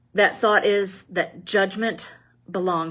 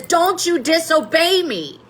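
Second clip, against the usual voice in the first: high-pitched mock yell, not full volume; infuriated schoolmaster's scream; 9 semitones higher than usual. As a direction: scold the boy in a raised, high voice. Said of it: "he's screaming at the top of his lungs".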